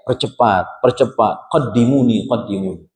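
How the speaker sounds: normal talking voice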